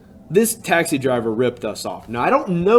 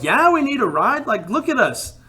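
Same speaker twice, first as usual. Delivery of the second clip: desperate relief; 10 semitones higher than usual. Retold or imitated. Stranded travellers answer eager driver.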